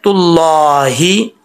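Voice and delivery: slow तुल्लाही with long thick "llaa"; correct reading. Shown as a demonstration. Teacher answering what is correct, careful recitation. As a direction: stretch तुल्लाही out to a clear "hee". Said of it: "तो ठीक क्या है".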